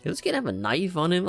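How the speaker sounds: ordinary talk, nothing marked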